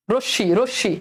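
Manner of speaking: রশ্মি split as "rosh-shi", m silent; pronunciation model. Shown as a demonstration. Teacher prompting students to say it, রশ্মি as "rosh-shi". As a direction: double the sh in each রশ্মি. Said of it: "এটার উচ্চারণ তোমরা করো".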